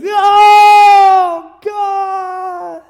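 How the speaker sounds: long, high, loud mock wail; wailing mourners. Group of mourners covering for the grieving widow, loud acted wailing. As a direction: wail it out, drawing each word long. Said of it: "there's a group of people saying".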